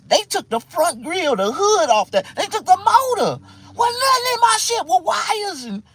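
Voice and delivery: loud, high-pitched indignant complaint; worked-up, yelling disbelief; outraged rant. Retold or imitated. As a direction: rant at full volume about being robbed. Indignant retelling of stripped truck.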